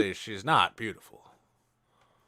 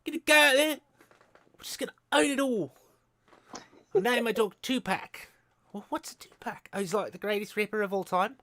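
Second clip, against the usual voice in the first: put-on little gangster kid; 10 semitones higher than usual. Imitating the Deadpool kid as a high little gangster. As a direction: brag like a small-time kid gangster. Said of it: "he's just plays the same character".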